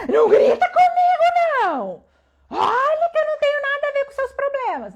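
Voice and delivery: very high, hammed-up angry yell; grito de volta. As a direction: act out yelling back, outraged. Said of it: "a pessoa gritou comigo". (grito indignado encenado, bem agudo)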